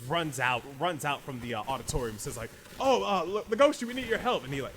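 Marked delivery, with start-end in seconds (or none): 2.8-4.4 higher, louder call for help; urgent plea. Acted. Partner runs out to Legoshi for help, urgent. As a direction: call out in mock alarm.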